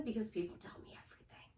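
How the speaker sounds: quick, hushed whisper; confiding whisper. Acted. Wife answering her own question, confiding whisper. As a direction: whisper it fast like gossip.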